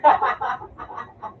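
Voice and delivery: hearty laugh tailing off; burst of laughter. Real, not acted. Player laughing after firing, hearty laughter. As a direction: laugh hard, then let it fade.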